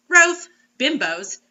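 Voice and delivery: ordinary talk, nothing marked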